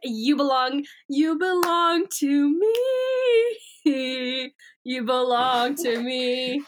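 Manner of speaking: slow, drawn-out singsong menace; possessive invader voice. Retold or imitated. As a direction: claim your host, stretching the words. Acts invader claiming its host.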